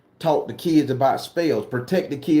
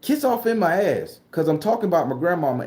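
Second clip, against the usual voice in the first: usual speaking voice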